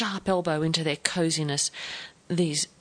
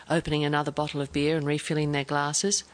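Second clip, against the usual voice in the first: ordinary delivery, nothing marked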